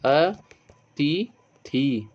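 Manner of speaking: अतिथि stretched very slowly, short ि at the end; teacher drawing out अतिथि for the class; slow careful demo. Shown as a demonstration. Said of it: "अतिथि में क्या होता है". (अतिथि को बहुत धीरे खींचकर बोलें)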